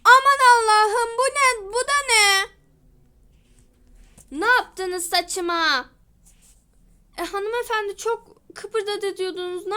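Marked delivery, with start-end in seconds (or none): 0.0-2.5 loud, high shriek of horror; horrified shout. Pretend. Pretend customer sees ruined hair. 4.3-5.9 angry question. Pretend. Customer confronts hairdresser.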